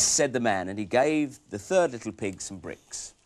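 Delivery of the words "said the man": ordinary delivery, nothing marked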